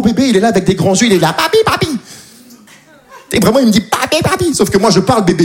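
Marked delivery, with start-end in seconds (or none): 1.4-1.9 much higher babbling; baby voice. Retold or imitated. 3.8-4.5 much higher babbling; baby voice. Retold or imitated.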